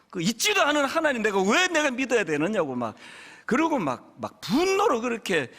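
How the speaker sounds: ordinary delivery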